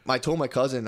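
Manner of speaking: normal talking voice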